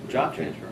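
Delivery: "Job transfer" echoed with a falling tune; downward inflection. Shown as a demonstration. Agent echoing the seller's answer with a downward voice swing. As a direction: end "transfer" low like a statement. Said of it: "you end it with a downward voice inflection".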